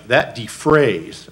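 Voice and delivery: defrays drawn out slowly and leaned on; slow down and weight the word defrays; emphasize defrays deliberately; stress on defrays. Shown as a demonstration. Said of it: "I emphasize the word defrays".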